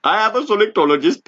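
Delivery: a hint of mock Indian, sing-song; teasing a friend with a mock Indian accent. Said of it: "you were starting to sound a little bit".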